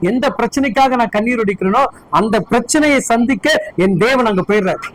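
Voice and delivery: ordinary talk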